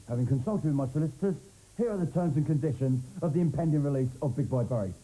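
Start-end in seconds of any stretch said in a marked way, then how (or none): none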